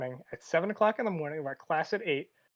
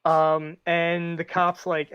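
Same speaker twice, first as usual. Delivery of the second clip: ordinary delivery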